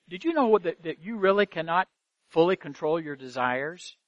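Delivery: ordinary delivery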